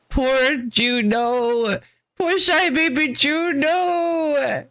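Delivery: drawn-out, teasing coo; slow sing-song mock sympathy; mock pity. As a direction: coo slowly as if pitying a baby. Mock pity for a game character.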